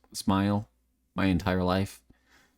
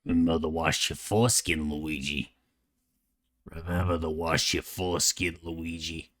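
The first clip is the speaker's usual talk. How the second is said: low, grumbling throat voice; Mario; 4 semitones lower, 3 dB quieter than usual. Pretend. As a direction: grumble it from the throat, trailing softer on the name. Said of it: "it grumbles my throat".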